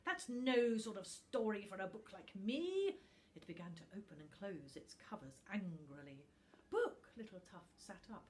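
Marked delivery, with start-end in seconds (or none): 0.0-3.0 loud, snarling creature voice; the wicked book snarls at Tuft. Acted. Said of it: "Book made a snarling noise". 6.7-7.1 high, loud startled shout; little Tuft cries out to Book in alarm; alarmed cry. Acted.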